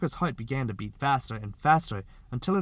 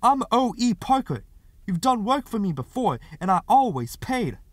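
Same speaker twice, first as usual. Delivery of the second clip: high, loud offended insistence; louder, higher, affronted pleading; indignant; 10 semitones higher, 7 dB louder than usual. A narrator's reading. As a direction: keep pushing the outrage. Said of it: "Parker cried indignantly".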